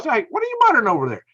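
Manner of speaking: high, quick puzzled call-out; retold challenge. Retells confronting the muttering classmate.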